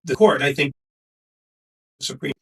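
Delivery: audio cutting in and out; choppy, dropping connection; corrupted glitchy audio. Real, not acted. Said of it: "your audio's cutting out".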